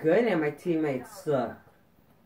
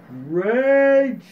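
loud, high shout of rage; 6 semitones higher, 9 dB louder than usual. Real, not acted. Gamer getting mad at the match, raging shout. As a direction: burst out yelling in frustration. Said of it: "I'm kinda getting mad right now".

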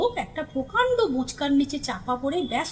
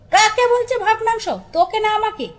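loud, high-pitched snap back; angry retort; 6 semitones higher, 5 dB louder than usual. A narrator's reading. Someone up the tree retorting to the old man, angry and loud. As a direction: fire the question back crossly. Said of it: "তেরে উত্তর হলো".

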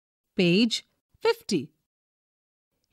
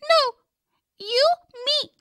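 put-on little girl; faster than usual. A narrator's reading. Child voice Jo.

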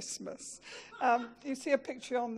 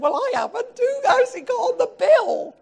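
high, loud mock denial; wife's innocent protest; 13 semitones higher, 12 dB louder than usual. Husband voicing his wife denying the game charges, high and loud. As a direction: play the innocent wife, pitched up.

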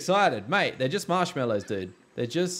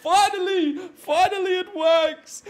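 high, loud laughing whoop of relief; triumphant cheer; 11 semitones higher, 4 dB louder, much slower than usual. Real, not acted. Gamer cheering when his ride finally works, laughing whoop. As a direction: cheer like you finally won.

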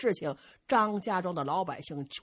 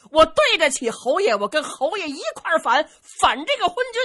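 higher, loud resolute declaration; defiant vow; 8 semitones higher, 7 dB louder than usual. Acted. Zhu Can tells Zhang Delu he will rebel, loud defiant vow. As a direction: swear it with fists on your chest.

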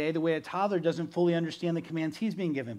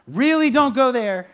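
much louder and higher on the warning; forceful warning; 7 semitones higher, 12 dB louder than usual. Retold or imitated. Exhorter warning the hearer off a path, loud and pressing. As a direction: plead hard, nearly shouting.

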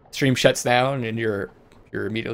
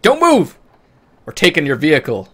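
high, loud bandit's order; shout; 4 semitones higher, 9 dB louder than usual. Acted. Bandit holding up travelers on the road, shouted high and loud. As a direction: shout it out as a hold-up. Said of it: "there was a shout".

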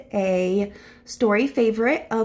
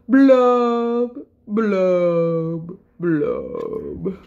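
glum fish blubbing; 2 semitones lower, 4 dB louder, much slower than usual. Acted. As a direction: draw out each blub, sinking down. Gloomy fish's drawn-out blubs.

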